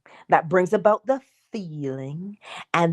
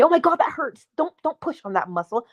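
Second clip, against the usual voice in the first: mimicking client; 7 semitones higher than usual. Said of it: "how many times people say to me".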